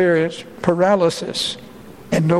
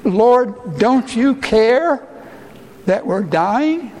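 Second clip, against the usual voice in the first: high, slow, anguished question; desperate plea; 5 semitones higher, slower than usual. Retold or imitated. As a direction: beg him to wake up and care. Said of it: "asked him this question".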